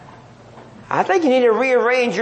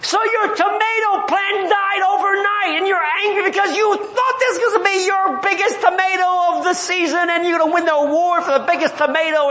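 usual speaking voice

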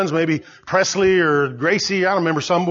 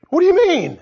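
higher, louder indignant question; indignant retort; 10 semitones higher, 4 dB louder than usual. Retold or imitated. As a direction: fire it back, offended. Lawyer retorts in retold argument.

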